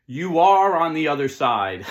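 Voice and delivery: very loud, high, drawn-out shout; full-volume shouted reply, stretched out; yelling back. Acted. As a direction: holler it slowly back across the river. Friend yells back across the river.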